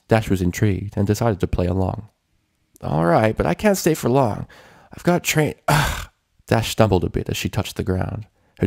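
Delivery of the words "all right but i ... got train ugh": higher, tired tomboy voice; sporty tomboy pony. A narrator's reading. Rainbow agreeing to go, tired higher voice. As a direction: play along casually.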